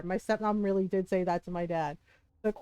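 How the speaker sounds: ordinary talk, nothing marked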